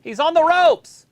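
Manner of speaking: high, loud, drawn-out cry; excited call-out. Real, not acted. Watcher calling the wrestling match to a co-host, excited call-out. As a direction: shout the match action out slowly.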